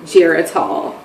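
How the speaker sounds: retold sheepish confession; dreading reveal. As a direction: let the name drop quietly after a wait. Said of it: "telling her so slow because I'm, like, dreading her".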